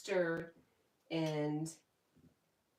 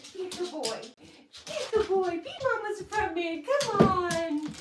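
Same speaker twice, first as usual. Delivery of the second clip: much higher, louder sing-song coaxing voice; 13 semitones higher, 8 dB louder than usual. Real, not acted. Owner coaxing her shy dog to come, high baby talk.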